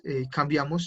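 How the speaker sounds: normal talking voice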